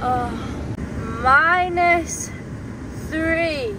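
minus three hoog en luid van verbazing; shocked disbelief. Real, not acted. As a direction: roep het ongelovig uit. Shock at the cold.

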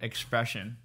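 normal talking voice

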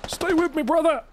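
high, hammed-up war movie plea; mock dramatic medic cry; man down shout. Dramatic mock medic over downed teammate.

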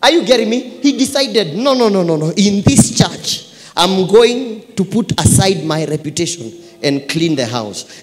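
loud, emphatic sermon delivery; forceful preaching. Real, not acted. Preacher on putting reputation aside, forceful preaching. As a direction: drive it home to the congregation.

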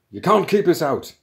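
defiant shout. Acted. Intruder pushing back a programmer, shouting defiantly. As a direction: shout down whoever bars the way.

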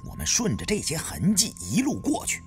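ordinary delivery, nothing marked

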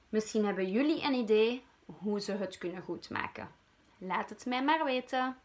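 put-on lively storyteller asking kids for ideas; talking to children. A narrator's reading. Storyteller asks the children for help, lively. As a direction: invite the young listeners warmly. Narrator addresses young listeners.

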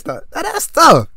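mocking tough. Real, not acted. Mocking opponent's rage.

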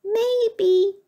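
very high, coy little squeak; tiny mouse voice. A narrator's reading. Mouse answers from out in the hall, tiny voice. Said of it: "came a tiny voice".

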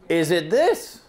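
normal talking voice

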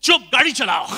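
loud, brusque order in Hindi; mock-bossy outburst; curt command. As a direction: shut the underling down loudly. Employer cuts off the Hindi speaker.

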